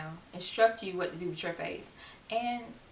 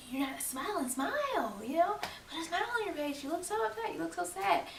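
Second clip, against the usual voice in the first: very high, whiny imitation of a nag; overdone high-pitched fussing; mock smile-nagging; 10 semitones higher than usual. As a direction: pester in an exaggerated high voice. Mimics people nagging her to smile.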